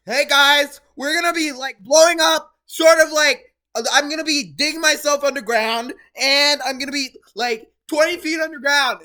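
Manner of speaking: loud hyped YouTuber intro voice; Mister Beast impression. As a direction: blast it like an overexcited creator intro. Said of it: "even Mister Beast does this where he's like".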